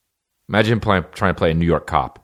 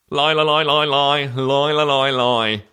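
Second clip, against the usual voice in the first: high-pitched mock British stammer; British stutter; 8 semitones higher, slower than usual. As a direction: stammer in an exaggerated British accent. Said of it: "That's how British he is".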